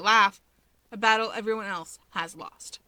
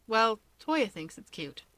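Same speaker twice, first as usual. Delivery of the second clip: plain reading voice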